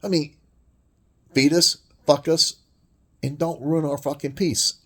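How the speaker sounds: normal talking voice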